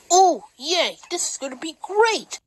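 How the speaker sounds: high, overdone fake cheer; sarcastic. Character picked for a team, sarcastic fake enthusiasm. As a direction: feign delight with swooping high pitch. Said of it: "No need to be so sarcastic".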